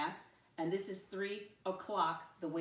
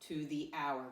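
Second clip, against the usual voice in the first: slow, deliberate classroom read-aloud; model reading; slower than usual. Shown as a demonstration. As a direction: read it out slowly, word by word. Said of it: "Let me read it the first time".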